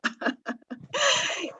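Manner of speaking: a brief, real, high laugh; short laugh. Real, not acted. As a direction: laugh lightly at your own joke. Laughs about the teacher in Galicia. (risada curta e espontânea)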